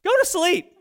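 high, loud fed-up outburst; exasperated dad. Retold or imitated. Dad exasperated by son's nagging.

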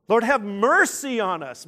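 a moderately loud acted plea; crying out. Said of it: "when they cry out".

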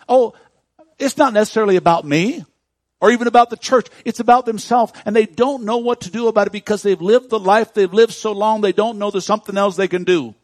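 loud, high preaching build; impassioned pulpit voice; preaching at full force. Acted. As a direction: drive it home to the congregation. Preaching swell about hurting people.

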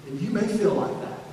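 normal talking voice

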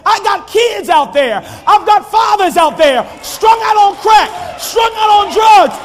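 high, loud booming plea as God; God speaking through a shouting preacher; God's voice, preached. Acted. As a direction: thunder it as God crying for his children. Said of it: "God said".

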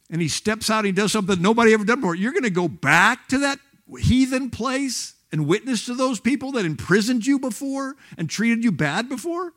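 impassioned sermon climax, pitched high; fervent preaching. Real, not acted. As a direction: build the challenge to a peak with amazement.

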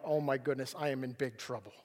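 low, hushed realisation of trouble; sinking dread. Retold or imitated. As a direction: let it sink, low and soft, as dread lands. Realising he will miss the bend.

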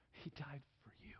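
hushed whisper, drawing them in. Real, not acted. Preacher to the congregation, hushed whisper. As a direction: whisper it like a secret. Preacher drops to intimate climax.

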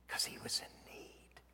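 soft unvoiced whisper. Real, not acted. Preacher whispering the beggar's need to the congregation. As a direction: breathe it out like a secret. Intimate aside in sermon.

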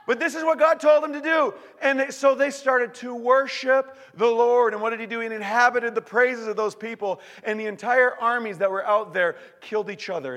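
high, loud proclamation; preaching at full force. Acted. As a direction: declare the victory.

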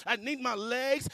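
loud, high-pitched arguing with God; desperate protest. Retold or imitated. Believer begging God over eyes and legs, desperate. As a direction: plead desperately at full volume.

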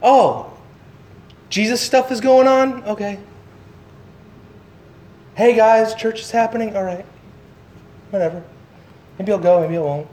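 lightly voiced shrug, trailing off; mock lazy brush-off; indifferent churchgoer. A casual believer hearing church is on, mock indifferent. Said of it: "So many times we respond".

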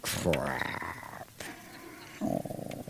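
under the breath, caught out; dismayed mutter. Retold or imitated. Landlord seeing the sheriff walk in, muttered dismay. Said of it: "and I'm like".

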